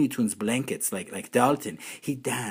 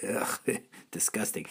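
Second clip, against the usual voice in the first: ordinary talk, nothing marked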